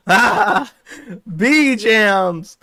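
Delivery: high, loud laughing "ah, bee jams"; laughing mock scream. [name] screaming at chat about the bees. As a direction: cackle it out as a yell. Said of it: "starts screaming".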